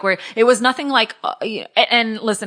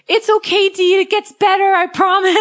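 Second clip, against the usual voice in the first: cheerful friend voice; 10 semitones higher, 5 dB louder than usual. Retold or imitated. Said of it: "one girl that does CrossFit with me was like".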